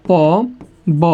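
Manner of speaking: "প", "ব" as separate, drawn-out sounds; letter by letter. Shown as a demonstration. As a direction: say "প", then "ব", with a clear pause between. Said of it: "পবন শব্দটা ভাঙলে কি হয়".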